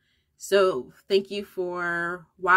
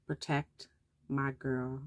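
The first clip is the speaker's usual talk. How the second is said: hushed, softer divine command; the Lord's voice; 3 semitones lower, 8 dB quieter, slower than usual. Retold or imitated. Mother retells hearing God at drop-off, hushed and solemn. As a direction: let it fall soft and solemn. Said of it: "I heard the Lord say".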